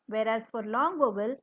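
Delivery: ordinary delivery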